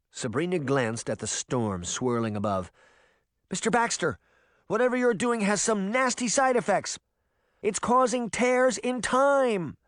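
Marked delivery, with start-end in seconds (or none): 3.5-9.8 shouting over noise. A narrator's reading. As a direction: call out above the roaring wind. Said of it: "she shouted over the noise".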